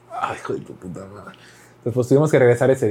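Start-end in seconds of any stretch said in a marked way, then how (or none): 0.1-1.4 low, very soft grumbled swear; muttered curse. Retold or imitated. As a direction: curse under your breath, imitating private frustration. Retold frustration at closed drop-off. (maldición fingida entre dientes)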